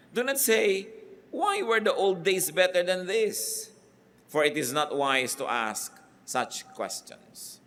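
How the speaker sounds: high, slow Bible reading; scripture reading. Acted. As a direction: proclaim the verse with weight.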